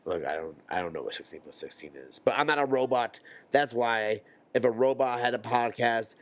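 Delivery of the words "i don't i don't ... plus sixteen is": ordinary talk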